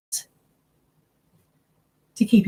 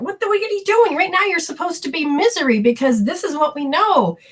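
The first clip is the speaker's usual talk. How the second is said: much higher, scolding inner voice; a fussy body scolding you for being happy; nagging body voice; 9 semitones higher than usual. Retold or imitated. Body scolds its owner for being happy, high and scolding. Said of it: "my body's going".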